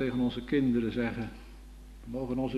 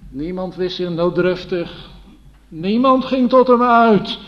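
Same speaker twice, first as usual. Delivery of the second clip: impassioned cry; 6 semitones higher, 10 dB louder than usual. Acted. Preacher climaxing on the crowd's blindness.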